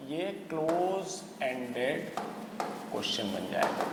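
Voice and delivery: slow, soft, word by word; slow dictation. Real, not acted. Teacher writing out the question type for students, slow dictation. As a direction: spell it out slowly, dropping low at the end.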